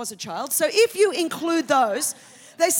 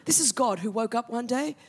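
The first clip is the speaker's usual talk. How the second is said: ordinary delivery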